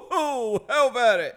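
loud, high and slow; jolly old guy. Retold or imitated. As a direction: boom it out like a cheerful old man. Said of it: "he went".

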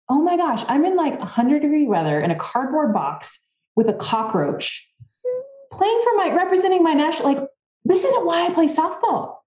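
high, strained disbelief, partly mocking; miserable exasperation. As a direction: wail at the absurdity of it all. Retold misery in cardboard box.

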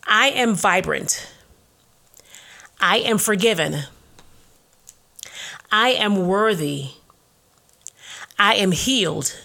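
slow, deliberate declaration with long pauses; slow affirmations. Acted. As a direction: declare each one slowly, with conviction.

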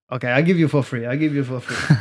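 lightly voiced Balinese seller; a hint of a Balinese masseuse making an offer; imitated Balinese masseuse. Masseuse haggling with a tourist over oil, lightly imitated.

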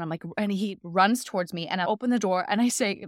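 ordinary delivery, nothing marked

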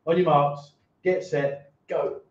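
ordinary delivery

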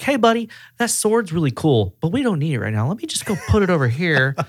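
high-pitched, friendly humoring voice; playful coaxing. Acted. Calming an angry buddy out of a fight, playfully coaxing. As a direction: talk him down lightly, pitched well up.

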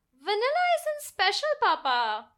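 put-on very high, pouty child voice; a girl objecting to plain vanilla; protesting little girl. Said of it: "she protested".

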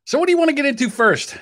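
loud high rant voice. Real, not acted. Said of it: "saving my voice for when I rant".

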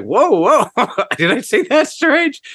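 high, laughing "whoa, did I say that strange"; bewildered and amused, pitched high; a boy startled at being teased; surprised kid. Retold or imitated. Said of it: "It's like".

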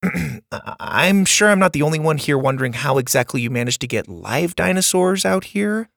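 put-on quick, eager kid voice; a bright boy rattling it off; nerdy boy voice. Bo asks a question.

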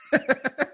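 sudden high laugh; high burst of laughter. Real, not acted. Host laughing at the mechanical engineer news. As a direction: laugh out in disbelief.

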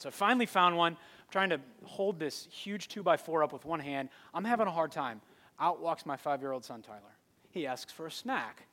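ordinary talk, nothing marked

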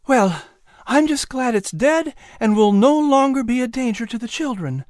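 mother glad the danger is gone; Sarah. A narrator's reading. Sarah's voice in the story.

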